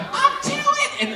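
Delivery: very high, slow drawn-out tattle; little brother threatening to tell; tattletale sing-song. Retold or imitated.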